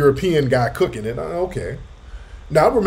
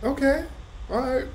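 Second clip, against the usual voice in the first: high, doubtful "all right"s; much higher, hesitant giving in to the vendor; reluctant okay; 13 semitones higher, 5 dB quieter than usual. Retold or imitated. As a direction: go along with it while you still don't trust it. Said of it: "I said".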